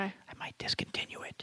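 hushed secret whisper, barely any voice. Real, not acted. Husband whispering to listeners behind his wife's back, hushed. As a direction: whisper it so your partner can't hear. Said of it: "I'm gonna say this low".

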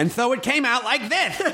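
high-pitched, very bad character impression; badly imitated film actor, pitched high; Wallace Shawn. Shown as a demonstration. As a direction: push the voice way up into a comic villain impression. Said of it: "I did a very bad impression of Wallace Shawn".

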